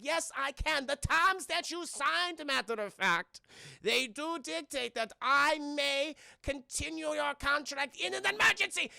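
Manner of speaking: hammed-up German mad scientist accent; evil German scientist accent. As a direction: sneer it as a cartoon German villain. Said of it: "stereotypical evil German Nazi scientist".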